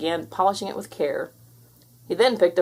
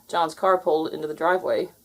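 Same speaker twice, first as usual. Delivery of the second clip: usual speaking voice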